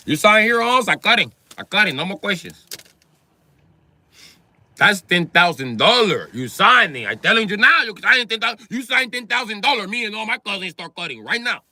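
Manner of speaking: pushy deal-making in a mock Mexican accent; insistent Mexican worker. Worker demands signed ten thousand dollar deal, pushy Mexican accent. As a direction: press for a signature, Mexican accent. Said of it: "The S A was like".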